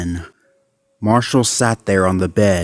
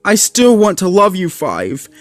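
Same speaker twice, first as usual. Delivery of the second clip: holding back sobs, head lowered; crying mutter; 9 semitones higher than usual. A narrator's reading.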